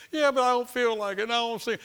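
high, whining objection; a believer whining about feelings, high and whiny; whiny doubter. Retold or imitated. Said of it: "doubter's complaint".

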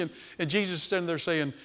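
usual speaking voice

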